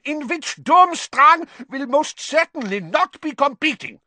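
very high, loud, furious outburst; exploding. A narrator's reading. Karkaroff refusing to let Durmstrang compete, exploding. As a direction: explode in outrage. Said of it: "exploded Karkaroff".

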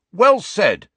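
loud, high bark of approval. A narrator's reading. Ernie to Hermione, barking approval. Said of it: "barked Ernie Macmillan".

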